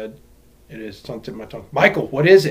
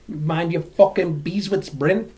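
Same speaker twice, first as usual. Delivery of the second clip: put-on slow, drawn-out Aussie accent; mock Australian accent; 2 semitones higher, slower than usual. Imitating funny Australians, exaggerated Aussie accent. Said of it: "Australians are some of the funniest people".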